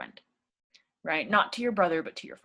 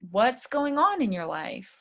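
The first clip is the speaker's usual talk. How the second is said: bright, higher friendly opener; friendly polite greeting; 7 semitones higher, slower than usual. Shown as a demonstration. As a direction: greet the neighbor warmly. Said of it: "you can start politely".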